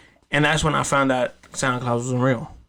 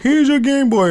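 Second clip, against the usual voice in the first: high, loud jolly Santa voice; mock Santa voice; 12 semitones higher, 6 dB louder than usual. Uncle dressed as Santa hands over the Game Boy, jolly high voice. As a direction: ham it up like a fake Santa.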